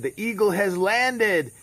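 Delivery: high, loud, drawn-out announcement; triumphant call. Retold or imitated. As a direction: call it out slowly and grandly from a distance. Triumphant call to man on arrival.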